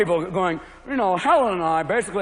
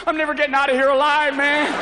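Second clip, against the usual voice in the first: higher, panicky and loud; paranoid golfer; 6 semitones higher, 3 dB louder than usual. Retold or imitated. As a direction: panic about the sand trap. Paranoid golfer in sand trap.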